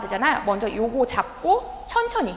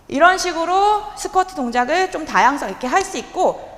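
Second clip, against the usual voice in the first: usual speaking voice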